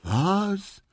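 high surprised cry; astonished what. Acted. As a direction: burst out in disbelief. Quawa astonished. (überraschtes, hohes Was)

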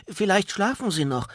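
hoher, lauter Zwerg, der einwendet; Einwand. Acted. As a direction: bremse die Freundin besorgt. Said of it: "wandte Zwerg Goliath ein". (Zwerg Goliath hält die Riesin vom Klopfen ab, hoch und besorgt)

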